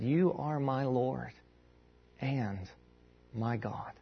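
slow, soft, low declaration of faith; solemn confession. Acted. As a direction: say it softly, pausing with reverence. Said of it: "What Thomas is doing here is he's saying".

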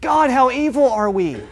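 high, hammed-up lament; mock-anguished wail to God; mock crying out. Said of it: "they cry out going".

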